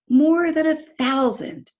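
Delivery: amazed emphasis. Real, not acted. Teacher wowing the class with a thousand eggs, amazed.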